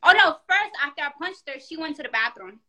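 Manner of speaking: normal talking voice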